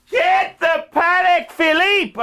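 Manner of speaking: high, loud, slow command; emphatic shout. Real, not acted. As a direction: drive it home at full voice. Climactic urging.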